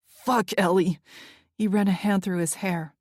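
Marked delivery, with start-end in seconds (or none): 0.1-1.0 angry outburst. A narrator's reading.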